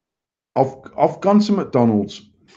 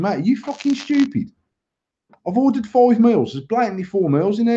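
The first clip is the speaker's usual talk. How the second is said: 1 retold angry rant; 8 semitones higher than usual. Retold row at McDonald's.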